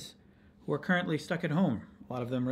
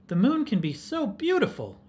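ordinary delivery

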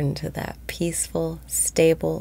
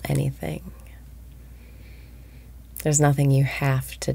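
guided meditation. Acted. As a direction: ease listeners into calm, breath by breath. Leads a daily guided meditation.

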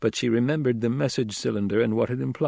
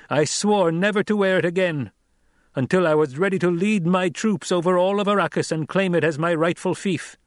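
higher, louder, commanding orator; young duke addressing tribe; 7 semitones higher, 4 dB louder than usual. A narrator's reading. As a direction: challenge the crowd with rising authority. Paul addresses the throng.

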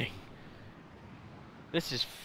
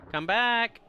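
much higher, louder yell of come back; shouting after enemy; 9 semitones higher, 6 dB louder than usual. Real, not acted. Chasing enemy in game.

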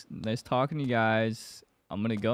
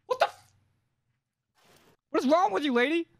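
shocked outburst. Real, not acted. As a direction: yell in shocked disbelief. Startled by a character.

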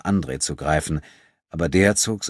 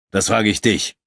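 louder, mildly sharp comeback; sharp retort; 4 semitones higher, 5 dB louder than usual. A narrator's reading. Irritated companion answering back, sharper than needed. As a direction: throw it back pointedly, a bit higher and louder. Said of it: "erwiderte Abudun schärfer".

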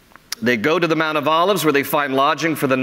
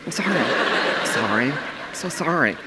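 soft apologetic wife; 5 dB quieter than usual. Retold or imitated. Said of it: "wife apologizing".

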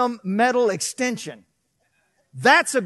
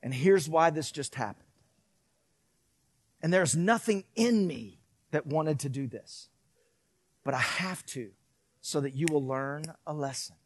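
softer, lower loving talk; quiet loving father; tender parent; 7 semitones lower, 7 dB quieter than usual. Retold or imitated. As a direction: gently reassure the child. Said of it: "And then I would say".

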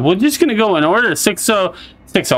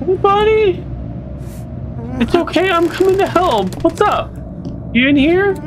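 high coaxing voice; 13 semitones higher than usual. Real, not acted. Player gently coaxing a scared hiding creature, high coaxing voice. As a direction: coax the frightened little thing out of hiding.

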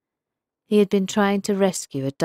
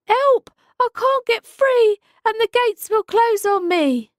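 high, loud panicked cry for help; trapped little fish screaming; struggling against restraints; 6 dB louder than usual. A narrator's reading. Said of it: "The little fish cried and thrashed about".

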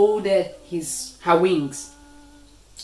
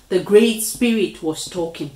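plain reading voice

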